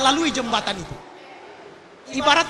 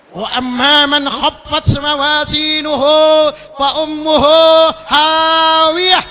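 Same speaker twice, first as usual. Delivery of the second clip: Quranic recitation; 2 semitones higher, 9 dB louder than usual. Acted. Preacher to congregation, chanting the verse loudly. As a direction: intone the verse like a qari.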